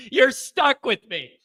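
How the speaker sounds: slow, high, full-voiced announcement; emphatic declaration. Real, not acted. Host telling viewers they're stuck, emphatic declaration. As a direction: declare it slowly to the crowd.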